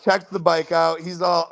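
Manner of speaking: normal talking voice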